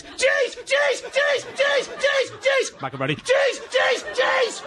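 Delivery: very high excited squeals of cheese; squealing for cheese. Pretend. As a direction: squeal like a greedy child. Demanding cheese, comic shouting.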